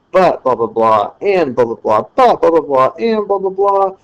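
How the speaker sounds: run-on rhythm rising to a peak; rushing, building chain. Shown as a demonstration. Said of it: "that's kind of the rhythm of a polyscendenton".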